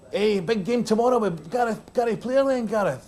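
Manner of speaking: high-pitched goading of a teammate; mock-excited ribbing, pitched well up; relentless teasing. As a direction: needle him in a high, excited voice. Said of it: "Bobby Windsor was relentless".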